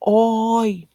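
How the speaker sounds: loud high Oi! called to a boat; calling out. Shown as a demonstration. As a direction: shout Oi! as if hailing a boat. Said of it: "as if you are calling out to the boat".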